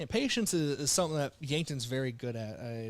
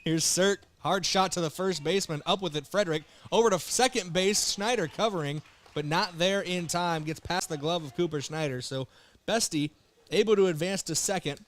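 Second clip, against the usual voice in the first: excited play-by-play; 3 semitones higher, 5 dB louder than usual. Acted. Live call of a hard-hit ball.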